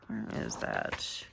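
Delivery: soft unvoiced mutter while searching; muttering to herself. Real, not acted. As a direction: whisper it under your breath.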